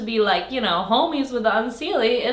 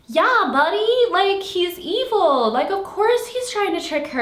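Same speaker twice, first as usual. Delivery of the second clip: higher, louder mock talk to a silly heroine; exasperated scolding; 9 semitones higher, 3 dB louder than usual. Reader scolds the fooled heroine in the book, exasperated. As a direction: roll your eyes at her and say it.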